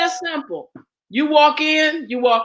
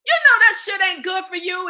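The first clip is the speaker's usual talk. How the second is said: laughing scold; 7 semitones higher than usual. Real, not acted. Laughing while scolding listeners over junk food.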